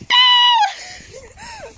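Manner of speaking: high loud cry of alarm; shocked shout. Real, not acted. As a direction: yell no in panic. Button touched.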